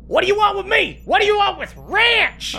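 very high, squealing protest; high-pitched frantic character; panicked character voice. Acted. Game master acts captive character.

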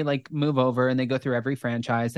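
ordinary delivery

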